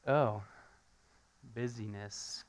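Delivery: normal talking voice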